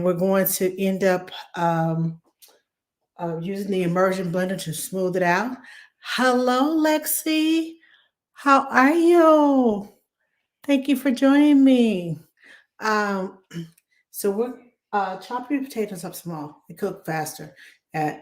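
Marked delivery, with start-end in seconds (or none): 6.0-12.2 higher, louder, drawn-out welcome; excited greeting. Real, not acted. Welcoming Lexi as she joins the live stream, excited and drawn out. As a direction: beam it out to a favourite viewer.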